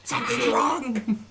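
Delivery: high, slow mock panic; panicked friend voice. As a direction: act out a friend freaking out.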